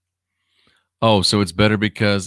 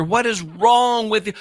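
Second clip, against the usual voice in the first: usual speaking voice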